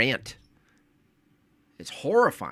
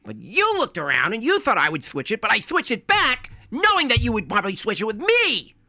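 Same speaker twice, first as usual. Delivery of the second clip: mock Vizzini rant; 10 semitones higher than usual. Acting out the poison cup scene.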